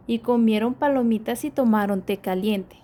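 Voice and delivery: plain reading voice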